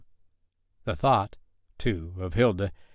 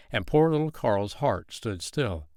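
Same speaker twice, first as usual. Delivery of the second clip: ordinary delivery, nothing marked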